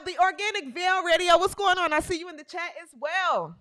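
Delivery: ordinary delivery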